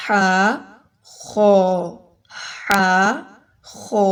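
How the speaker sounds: ہا light and thin, خو heavy and full; thin ha, thick kho. Shown as a demonstration. Teacher contrasting thin ہا against heavy خو. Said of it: "ہا کو باریک پڑھی اور خو موٹا".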